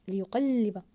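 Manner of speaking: "ليقلب" slow, long doubled ل after ق; stressed doubled letter. Shown as a demonstration. Teacher confirming the doubled lam, stressed doubled letter. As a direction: hold the second ل in "ليقلب". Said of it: "ضاعفنا حرف اللام".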